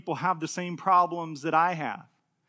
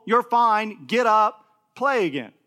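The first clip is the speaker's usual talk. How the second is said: loud, matter-of-fact, brushing the fuss aside; firm reassurance; 4 semitones higher, 7 dB louder than usual. Retold or imitated. Father brushing off his toddler's fake crying, firm and louder. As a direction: tell him plainly he's fine and to play on. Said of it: "except to be told".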